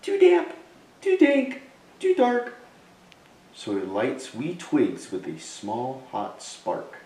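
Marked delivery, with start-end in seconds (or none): 0.0-2.6 very high, loud, tiny mouse squeak; a shrill-pitched mouse complaining about the cave; squeaky mouse. Acted. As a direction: squeak it out like a fussy little mouse. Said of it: "Mouse squeaks".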